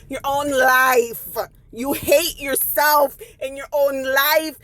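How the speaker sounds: very slow, drawn-out scolding; scornful emphasis. Acted. Traveler scolding the never-satisfied woman, slow and scornful. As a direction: stretch each word to drive it home.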